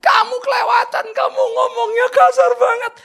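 high, wailing imitation of a tearful girlfriend; loud mock-weepy complaint; mock crying. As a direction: sob out the accusation, hammed up. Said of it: "Dia nangis".

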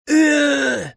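high wail through tears; tearful howl. Acted. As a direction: howl in pain, near crying. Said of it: "завыл Алексей сквозь слёзы".

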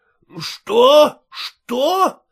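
loud outraged what, a touch of a yell; shouting. A narrator's reading. Konkonych at Sergei's defiance, shouting. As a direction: bark it back in disbelief. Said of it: "закричал Конконыч".

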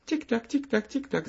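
high, light tick-tock patter; little feet ticking down the stairs; ticking chant. A narrator's reading. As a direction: tap it out like tiny shoes on stairs. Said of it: "забарабанили по лестницам".

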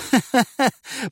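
high giggle at his expense; mocking giggle. A narrator's reading. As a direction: snicker from the shadows. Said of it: "посоветовал голос".

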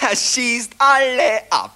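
gleeful chuckle through the line; relishing laugh about the marksman; laughing admiration. Acted. Admiring the sharpshooter Novak.

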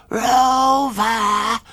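high, long drawn-out summons; dog-whistle call. A narrator's reading. Gigglerin calling a dog only dogs hear, whistle-high. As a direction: stretch the name like a high whistle. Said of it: "ähnlich dem Ton einer Hundepfeife".

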